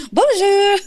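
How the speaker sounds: put-on high, loud sing-song "Bonjour" like an old French landlady; old French lady bonjour. Explaining her goofy greeting, with the old French lady's high bonjour. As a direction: trill it up high like a tiny French grandma. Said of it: "I say it like that because".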